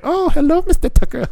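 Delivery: very high, sing-song greeting; high, teasing nurse voice; giggly nurse. Retold or imitated.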